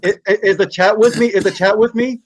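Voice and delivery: loud, high call to the viewers; calling out to chat. Real, not acted. Streamer calls loudly out to his chat for backup. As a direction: shout the question out to the live chat.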